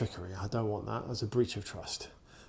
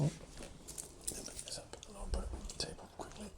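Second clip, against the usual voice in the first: high, soft whispered aside; 10 semitones higher, 12 dB quieter, faster than usual. Real, not acted. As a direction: hush it so the dog isn't startled. Quiet aside near dog.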